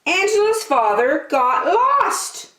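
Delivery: plain reading voice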